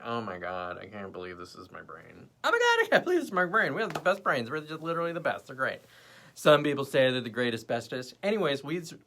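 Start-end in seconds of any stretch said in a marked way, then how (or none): 0.0-2.3 low, soft, awed murmur; quietly stunned by her own thought; hushed amazement. Retold or imitated. Retelling her realization in a low hush. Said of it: "I was like". 2.4-5.8 high, loud, fast and thrilled; excited gushing. Retold or imitated. Retelling her brain moment as high, fast excited gushing. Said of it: "I was like".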